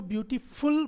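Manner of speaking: ब्यूटीफुल with a stressed FULL at the end; ब्यूटीफुल mispronounced as beauty-FULL; incorrect full ending. Shown as a demonstration. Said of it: "incorrect pronunciation है".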